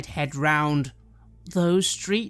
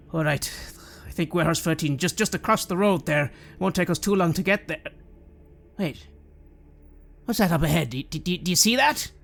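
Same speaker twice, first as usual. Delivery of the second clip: lightly voiced, louder watchman growing uneasy; McGregor the watchman; 3 semitones higher, 6 dB louder than usual. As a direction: stammer a little at something ahead.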